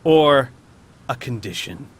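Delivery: very slow, grandiose villain challenge; theatrical monster boast with long pauses; imperious beholder. Acted. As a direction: space out each phrase, punching key words. Beholder sets the maze challenge.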